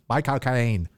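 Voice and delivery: Cockney accent. Shown as a demonstration. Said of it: "as you say in a Cockney accent".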